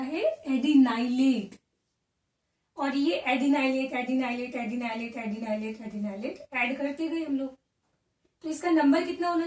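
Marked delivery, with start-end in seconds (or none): 3.3-6.4 trailing repetition. Shown as a demonstration. Teacher showing units added one after another, trailing repetition. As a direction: repeat it on and on, letting voice drop away. Rattles off a repeatedly added unit.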